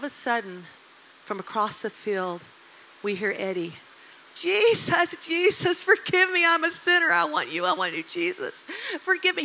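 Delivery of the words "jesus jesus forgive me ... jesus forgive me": high, loud mock weeping prayer; hammed-up tearful pleading; imitated wailing. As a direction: act out a teenager wailing for forgiveness, dipping then rising. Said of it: "He's weeping, he's wailing, he's crying".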